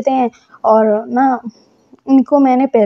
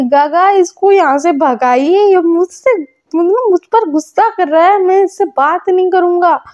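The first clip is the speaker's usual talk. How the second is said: sulky complaint; 6 semitones higher than usual. Retold or imitated. Complains to elder about partner.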